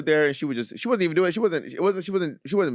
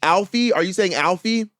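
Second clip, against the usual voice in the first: "Alfie" high and loud, rising both times; raised questioning tune on "Alfie"; insistent checking; 6 semitones higher, 4 dB louder than usual. Acted. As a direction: keep querying "Alfie" as if unsure. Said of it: "just keep saying the thing".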